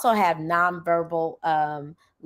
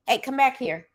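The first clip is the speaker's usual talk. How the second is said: higher-pitched teacherly call-back; acted teacher summons, pitched up; calling a student back; 8 semitones higher than usual.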